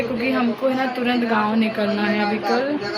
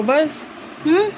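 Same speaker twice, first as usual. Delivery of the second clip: usual speaking voice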